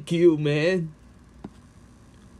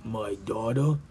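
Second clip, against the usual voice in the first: ordinary talk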